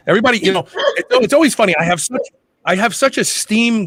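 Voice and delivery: bursting out high and loud; excited. Real, not acted. Excited aside to panel.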